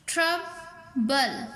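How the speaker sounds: "Trouble" slowly, with a gap before the final बल; split into syllables. Shown as a demonstration. Teacher showing the division rule, "trouble" in two parts. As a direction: divide "trouble" into two spaced beats. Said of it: "division भी इस तरीके से होगी".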